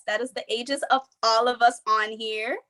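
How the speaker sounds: ordinary talk, nothing marked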